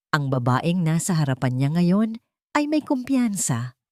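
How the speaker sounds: usual speaking voice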